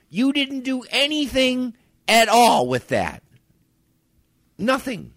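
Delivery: exasperated rant. Retold or imitated. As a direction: tell her to stop, fed up. Ranting at Facebook friend.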